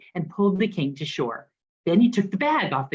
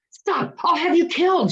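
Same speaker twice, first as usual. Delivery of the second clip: high, loud furious shout; the king threatens, voice shooting up high; 12 semitones higher, 4 dB louder than usual. A narrator's reading. Furious king shouts a death threat at the wise man. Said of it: "shouted the king".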